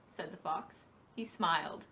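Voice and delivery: plain reading voice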